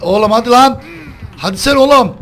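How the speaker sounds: high, loud frantic cries; frantic shouting. Real, not acted. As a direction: yell in a desperate rush. S0 escapes then downed by killer. (çaresiz, telaşlı bağırış)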